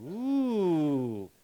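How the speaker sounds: rising ooh of delight; mock excitement; excited ooh. Shown as a demonstration. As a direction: sound thrilled by the news. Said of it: "How about this one?".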